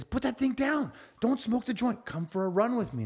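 high, pleading rabbit voice; rabbit urging. Acted. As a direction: coax him off it in a squeaky voice. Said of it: "And he says".

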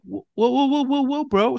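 a retold cop backing off in mock surprise; mock alarm. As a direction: play it startled and placating. Retold reaction to panhandler.

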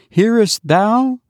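put-on high, sweet little child calling his mother; a small dead child greeting his mother with joy; child's voice. A narrator's reading. Dead child to his weeping mother, high sweet child voice. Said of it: "said the child".

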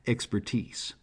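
soft chuckle on expertise; chuckling. Acted. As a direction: laugh it off quietly. Chuckling at his own price.

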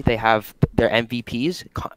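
ordinary talk, nothing marked

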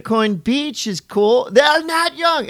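high, loud, slow blurted call; flustered commentary. Retold or imitated. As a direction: caught mid-sentence, blurting. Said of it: "the highlight is".

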